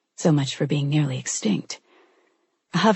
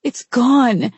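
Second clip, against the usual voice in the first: louder, breaking into tears; tearful cry; 6 semitones higher, 4 dB louder than usual. A narrator's reading. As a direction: cry it out as it hits her. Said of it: "tears welled there".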